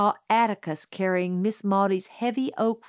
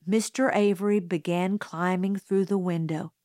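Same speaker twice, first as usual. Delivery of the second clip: usual speaking voice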